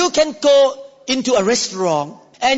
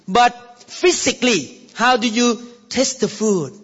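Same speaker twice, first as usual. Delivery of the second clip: ordinary talk, nothing marked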